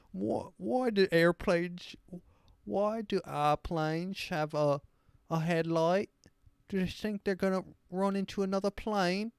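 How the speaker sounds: hammed-up baffled stupid Brit; dumb British accent. Dumb Brit asking about airplane headlights, dim British accent. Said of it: "just absolute most shit stupid".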